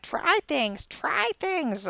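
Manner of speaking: high, soft witchy croon; witch voice. Pretend. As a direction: sing it out like a cackling witch. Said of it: "I made myself sound like a witch".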